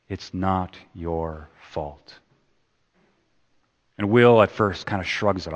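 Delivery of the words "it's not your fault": soft, slow and tender; quietly telling him it isn't his fault; gentle reassurance. Acted. Counsellor softly comforting a troubled young man, slow and tender. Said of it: "looks at Will and says".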